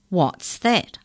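gravelly creature. A narrator's reading. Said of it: "unpleasant, gravelly voice".

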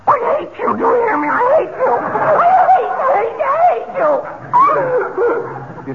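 very high, screeching tantrum; shrieked outburst, pitch far above normal; hysterical rage. Acted. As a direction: scream it in helpless fury. Traveller curses the customs officer.

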